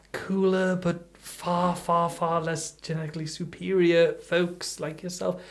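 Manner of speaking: ordinary delivery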